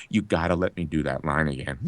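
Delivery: restrained, low-pitched request to a director; low-key plea. Retold or imitated. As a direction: beg under your voice, low and contained. Retold plea, first low.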